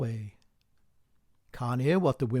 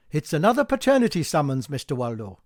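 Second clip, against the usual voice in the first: fast, louder teasing; the postman teasing Mr Waldo; quick teasing aside; 5 dB louder, faster than usual. Acted. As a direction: rattle it off with glee.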